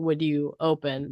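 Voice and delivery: ordinary talk, nothing marked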